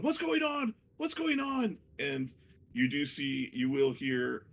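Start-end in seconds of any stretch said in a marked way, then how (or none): 0.0-1.8 very high-pitched mock shouting; high, strained crowd voice, hammed-up; prisoners shouting out. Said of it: "other prisoners shouting out".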